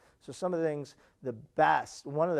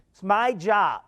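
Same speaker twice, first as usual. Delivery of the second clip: louder, higher, firm retold voice; stern niece; 6 semitones higher, 8 dB louder, slower than usual. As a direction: insist on it like a proud rule-keeper. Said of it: "She got real stern".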